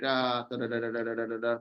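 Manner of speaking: low, quiet monotone "da-da-da"; soft droning placeholder syllables on one note; filler da-da-da. Real, not acted. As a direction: fill the gap while you search for the word. Stalls while recalling a term.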